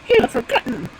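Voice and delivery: high squeaky bird voice; high-pitched bird reply; stork answering. Acted. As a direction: pipe it up as a stork.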